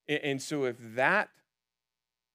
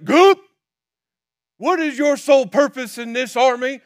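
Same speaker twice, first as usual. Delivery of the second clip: much higher, much louder shouting drill sergeant; drill sergeant bark; 14 semitones higher, 17 dB louder than usual. Retold or imitated. Drill sergeant screaming at Forrest in the barracks. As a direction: yell it in his face like a drill sergeant.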